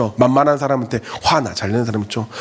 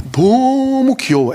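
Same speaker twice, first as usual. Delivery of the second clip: gushing; 8 semitones higher, much slower than usual. Retold or imitated. Pastor gushing over cute kid.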